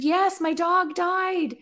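high, drawn-out mock wail; imitated bawling woman. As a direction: lightly act out a tearful confirmation. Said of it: "starts bawling her eyes out".

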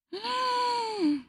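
long, very high-pitched gasp. Real, not acted. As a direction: draw out a high, overwhelmed gasp. Listener reacting to the romance.

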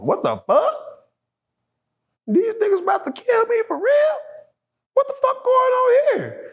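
very high, shouted terror and confusion; a frightened man shouting at armed police; panicked disbelief. Retold or imitated. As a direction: yell it like you think you're about to die. Fearing cops will kill him.

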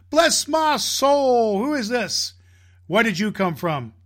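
big, high, loud jolly Santa exclaiming, slow at first; jovial Santa. Acted. Santa greeting the little girl, big jovial surprise. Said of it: "with a big jovial voice".

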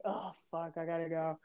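very soft, retold aside; muttered exit. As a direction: slip out under your breath. Man muttering he must leave.

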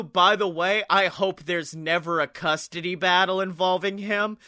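normal talking voice

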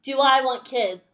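ordinary talk

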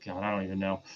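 usual speaking voice

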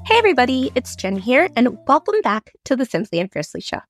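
ordinary delivery

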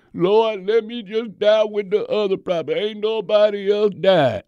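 high, loud, self-pitying plea to God; whining Elijah. Retold or imitated. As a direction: wail it like a prophet feeling sorry for himself.

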